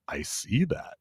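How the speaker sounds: imitating a sober friend, dry and hushed; deadpan quiet quote. Sober friend greets the tipsy table, deadpan hush. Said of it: "Just like that".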